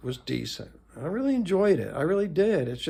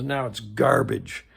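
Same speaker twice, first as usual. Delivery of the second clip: mock Walter Matthau; 4 semitones lower, 4 dB louder, slower than usual. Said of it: "throws it against the wall and says".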